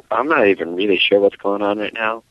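usual speaking voice

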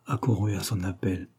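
ordinary delivery

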